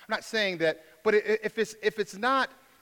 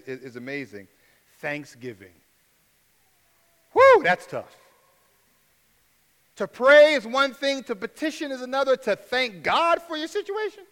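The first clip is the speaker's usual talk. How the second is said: ordinary delivery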